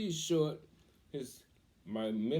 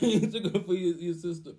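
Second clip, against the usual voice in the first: laughing imitation; 7 semitones higher, 7 dB louder than usual. Laughs while repeating Pops.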